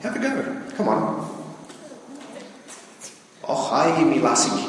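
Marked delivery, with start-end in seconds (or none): 3.4-4.7 piratical attempt at a Scottish accent; bad Scottish accent. Shown as a demonstration. Preacher showing congregation a Scottish accent, badly done. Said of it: "I'm not really good at a Scottish accent".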